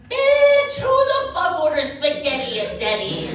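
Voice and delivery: loud, high sassy drawn-out bitch; sassy friend. Acted. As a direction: belt it out like a sassy queen.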